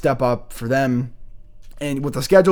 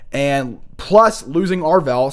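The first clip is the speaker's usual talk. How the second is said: ordinary delivery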